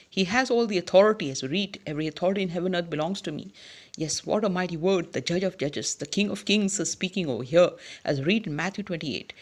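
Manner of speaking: ordinary talk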